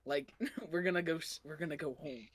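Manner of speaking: a hushed, broken mock comfort. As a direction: murmur it like a man in shock. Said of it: "he's like".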